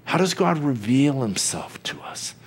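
usual speaking voice

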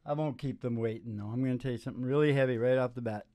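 ordinary delivery

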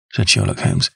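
ordinary delivery

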